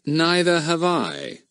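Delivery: slow dry deadpan; understated irony. Acted.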